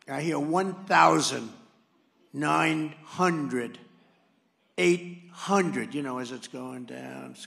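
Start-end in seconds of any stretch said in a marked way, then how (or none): 0.4-5.9 computer altitude callout. Retold or imitated. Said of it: "a computer voice".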